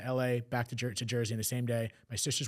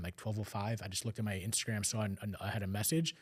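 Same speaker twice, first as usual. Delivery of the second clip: ordinary talk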